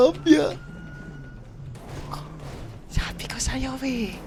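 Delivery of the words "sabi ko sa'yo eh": hushed, unvoiced whisper; whispering while hiding. Real, not acted. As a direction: whisper so nobody hears. Hiding from police.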